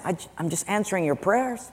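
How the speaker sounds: lightly acted, soft-voiced innocent reply; God. God explaining to a complaining churchgoer, softly. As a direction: answer quietly, a hint of mild protest. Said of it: "God is saying".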